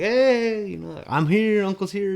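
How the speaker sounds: high, bright, loud call through the door; cheerful uncle greeting. Retold or imitated. Uncle calling to his nephew at the window, excited and high. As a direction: announce yourself to the kids, beaming.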